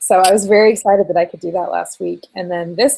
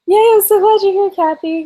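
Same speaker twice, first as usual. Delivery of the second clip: high, louder happy yay; excited greeting; 12 semitones higher, 5 dB louder than usual. Real, not acted. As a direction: beam out a real welcome. Greeting viewer Kathy excitedly.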